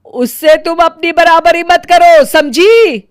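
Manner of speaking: loud, high, furious snap at the daughter-in-law; angry scolding. Acted. Mother-in-law yelling at her daughter-in-law, furious. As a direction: put her firmly in her place, shouting.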